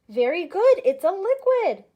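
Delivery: loud, high, cheerful praise; enthusiastic praise. Acted. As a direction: cheer like a kids show host. Praising pretend answers from kids.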